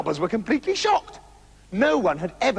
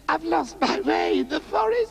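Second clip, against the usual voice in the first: higher, whiny lost man; a sniveling man who has lost his way; lost stranger; 9 semitones higher than usual. A narrator's reading. The lost man tells Trousers he is lost, whining.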